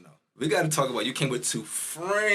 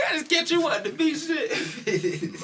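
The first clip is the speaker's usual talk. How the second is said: high, slow laughing tease; 13 semitones higher, slower than usual. Real, not acted. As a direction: laugh through the teasing line. Teasing friend, laughing.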